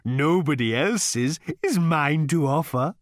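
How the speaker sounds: high, slow, courteous cow; polite cow voice. A narrator's reading. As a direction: offer yourself like a cow waiter. The animal speaks.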